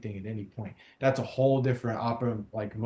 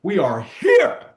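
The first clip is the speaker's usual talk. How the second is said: loud, slow shouted rally call; triumphant shout; 9 semitones higher, 12 dB louder, slower than usual. Real, not acted. Host closing the rally to his guest, slow triumphant shout. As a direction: roar it out in triumph.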